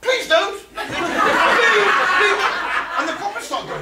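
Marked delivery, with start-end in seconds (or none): 0.0-2.4 hammed-up begging, much higher and louder through a laugh; pleading judge. The judge begging the story's teller to stop, hammed-up high pleading. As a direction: beg like a panicked judge. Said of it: "the judge goes".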